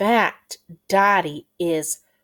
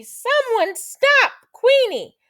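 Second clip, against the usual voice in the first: high, loud call for help; crying out; 7 dB louder than usual. Acted. Robbed quail calling after the thief, crying out. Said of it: "cries Quincy".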